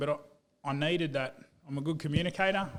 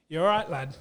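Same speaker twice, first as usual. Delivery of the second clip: Yorkshire coach; 2 semitones higher, 8 dB louder than usual. Retold or imitated. Yorkshire coach phoning his player on Christmas Day, in a broad Yorkshire accent. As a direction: imitate a gruff Yorkshire rugby coach calling up a lad. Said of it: "really good Yorkshire accent".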